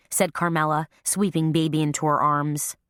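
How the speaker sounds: ordinary delivery, nothing marked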